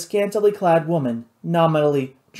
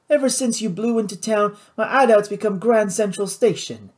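higher-pitched seething complaint; 6 semitones higher than usual. A narrator's reading. Louis blaming Nathan for his crowded hideout, seething. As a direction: complain with contained anger. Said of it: "Louis seethed".

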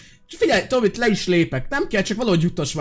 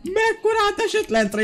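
magasan, lassan elnyújtva; csodálkozz rá az esésre; meglepett felkiáltás; 14 semitones higher, slower than usual. Real, not acted. Meglepődik az esésen. (elnyújtott csodálkozás)

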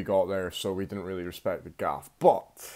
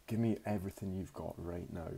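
hushed robber's demand, much softer; low menacing undertone; 3 semitones lower, 11 dB quieter than usual. Retold or imitated. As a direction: imitate a threat kept from being overheard. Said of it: "low in his voice so no one would hear him".

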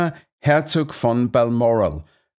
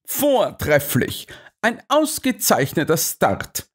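raised, delighted-sounding call; sudden exclamation; 6 semitones higher than usual. A narrator's reading. Holmes cries out at the excellent start, excited. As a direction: exclaim with a drawn-out first word. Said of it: "rief er plötzlich".

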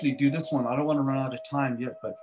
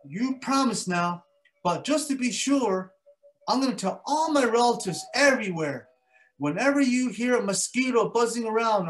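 stern mosquito; 8 semitones higher, 4 dB louder than usual. Acted. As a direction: lay down the rule, high and firm. Mosquito lays down the law.

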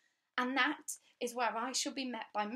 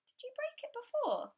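put-on much higher girlish question; girl asking the stern governess, high and polite; young girl's voice. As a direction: ask politely like a shy girl. Child Maya asks the governess.

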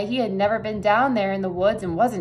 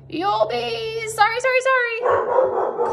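very high, drawn-out goose warning; goose calling out; 13 semitones higher, slower than usual. A narrator's reading. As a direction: call slowly in a high goose squawk. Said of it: "called the goose".

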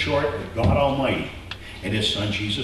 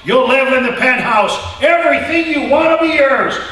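tempting false god; 12 semitones higher, 6 dB louder than usual. Acted. The gods of this world lure people with loud promises. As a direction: sell the lie loudly. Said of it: "The gods of this world will say".

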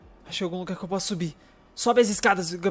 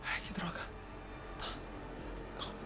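much softer whispered curse; 2 semitones lower, 19 dB quieter than usual. Real, not acted. Gamer hiding from shooters curses, whispered. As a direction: curse under your breath. Hiding, cursing under breath.

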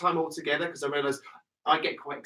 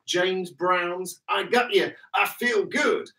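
a light American accent on the song title; mock American accent; 4 semitones higher, slower than usual. Shown as a demonstration. Instructor says the [name] title with a hint of an American accent. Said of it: "better than my American accent".

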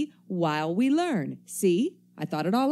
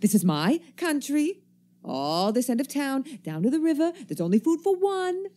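rising higher and higher, guarding his ground; territorial warning; 10 semitones higher than usual. Acted. Stranger warning the newcomer off his patch, rising sharply. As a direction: stake your claim, pitch climbing.

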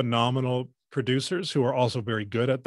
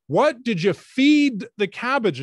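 high, loud, slow, every word spelled out; over-enunciate as if to a deaf man; slow loud question; 10 semitones higher, 6 dB louder, slower than usual. Retold or imitated. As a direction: interviewer raising her voice and slowing right down. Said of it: "she said".

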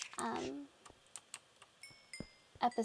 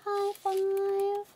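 high-pitched playful voice; squeaky high five; 8 semitones higher, 4 dB louder than usual. Pretend.

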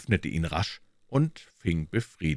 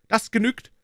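sharp, much higher and louder cut-off; stern; 5 dB louder than usual. A narrator's reading. Inspector ending the interrogation, stern sharp cut-off. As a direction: shut him down firmly. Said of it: "sagte Hopkins strenge".

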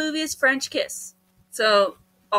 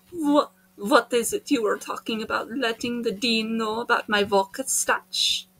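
put-on Russian voice; Russian accent; 3 semitones lower, 5 dB quieter than usual. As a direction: question them in a Russian accent. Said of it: "I'm doing the Russian voice".

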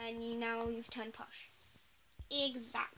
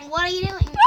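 loud, higher outcry; shocked scolding; 6 semitones higher, 17 dB louder than usual. Acted. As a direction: demand an answer, loudly. Startled scolding of the dog.

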